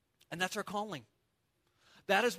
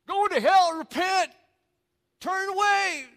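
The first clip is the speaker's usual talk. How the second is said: much higher, much louder bullhorn shouting; hammed-up street preacher yelling at passersby; imitating street preacher yelling; 10 semitones higher, 11 dB louder, slower than usual. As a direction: bellow it like a corner preacher with a bullhorn. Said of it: "You know, they're just yelling".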